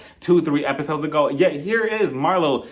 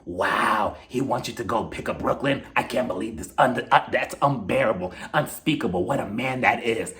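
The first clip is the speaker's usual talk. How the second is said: lower, put-on nosy friend voice; mock Marlo voice; 5 semitones lower than usual. Vlogger imitating Marlo reading Kenya's texts, lower put-on voice. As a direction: play Marlo as a fake-shocked busybody.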